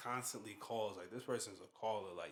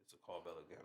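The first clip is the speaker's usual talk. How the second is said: usual speaking voice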